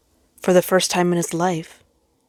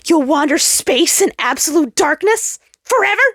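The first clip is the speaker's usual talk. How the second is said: much higher, loud yelling voice; yelled curse; 11 semitones higher, 5 dB louder than usual. A narrator's reading. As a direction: hurl it at the abandoned sun. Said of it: "yelled at him before they abandoned".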